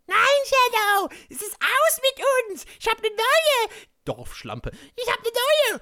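very high, loud falsetto woman's voice; hammed-up rejection. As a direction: squeal the break-up in mock falsetto. Narrator voices Regine rejecting Shadow.

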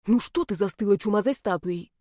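ordinary delivery, nothing marked

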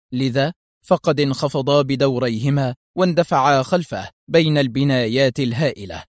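ordinary delivery, nothing marked

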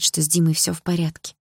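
usual speaking voice

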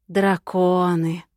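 slow, stretched-out word; drawn-out drawl. A narrator's reading. Witch addresses the captive dragons, slow drawl. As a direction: draw the vowel out disdainfully. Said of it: "протянула она".

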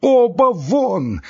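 high, loud, long-drawn cry; enraged shout. A narrator's reading. Doctor keeps driving both men out, enraged shout. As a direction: shout them out with stretched words. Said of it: "продолжал кричать Андрей Ефимыч".

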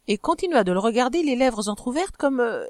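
usual speaking voice

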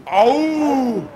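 sharp high yelp of pain; loud startled ouch; cry of pain. Acted. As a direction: yelp as the slap lands. Son slapped by his mother.

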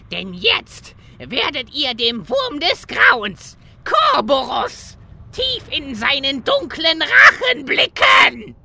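menacing announcement growing into a shout; villain building up. Acted. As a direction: build from threat to a roar. Villain unleashes the worm.

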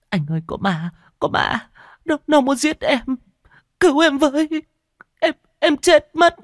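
high, loud, panicked sobbing; sobbing in terror. A narrator's reading. Frightened woman sobs to her boyfriend about the ghost. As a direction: cry out for help, shaking with fear. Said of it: "Cô ôm ghì lấy anh nức nở".

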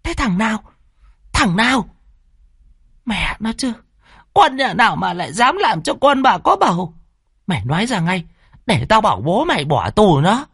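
high-pitched angry tirade; mother's furious, shrieky scolding; hissed fury. Acted. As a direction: demand and threaten in a strained high voice. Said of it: "bà lại rít lên".

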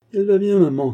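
high, loud cry; cheeky young rascal shouting back; shouted reply. Acted. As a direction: call it out loudly to mother. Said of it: "cria Rocambole".

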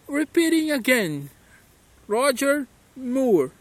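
put-on slow British-accented name; imitating a British accent. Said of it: "Sotaquezinho dele, como é?". (sotaque britânico do Roger Moore)

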